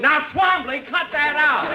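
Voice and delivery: high, sharp rebuke; scolding. Acted. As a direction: tell him off sharply. Scolding the train announcer.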